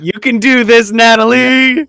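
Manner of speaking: loud, high rallying shout; cheering on. Real, not acted. Game master cheering Natalie on for the roll, loud cheer. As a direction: call out encouragement, drawing out the name.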